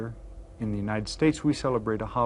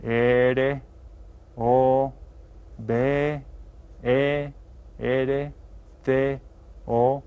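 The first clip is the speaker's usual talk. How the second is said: spelling aloud; much slower than usual. Shown as a demonstration. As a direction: spell the name out slowly for the class. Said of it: "Try saying the letters".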